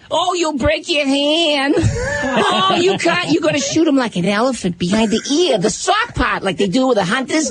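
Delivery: put-on high, shrill old-lady voice throughout. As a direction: squeal it with mock fear. Jenny voiced, long rant.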